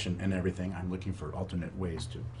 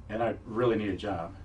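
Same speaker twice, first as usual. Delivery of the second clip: usual speaking voice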